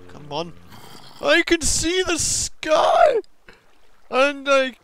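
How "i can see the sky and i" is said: high, loud, slow elated shout; drawn-out triumphant yell; joyful shout. Real, not acted. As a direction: shout it out in relief. Emerging from cave.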